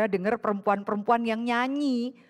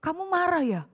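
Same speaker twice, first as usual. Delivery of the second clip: higher, lightly voiced husband asking; 7 semitones higher than usual. Husband asks his wife whether she's angry, lightly voiced. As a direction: ask her gently if she's angry. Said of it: "Suami saya tak bilang".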